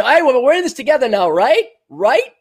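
high, sarcastic coaxing "right? right?"; mock pleading. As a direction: mimic a fake friend begging to agree. Mocking leftists asking for unity.

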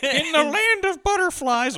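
high, slow, cutesy toy bear voice; sing-song storybook teddy bear; Teddy Ruxpin voice. Pretend. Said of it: "I don't know what Teddy Ruxpin sounds like".

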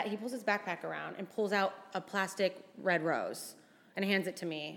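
normal talking voice

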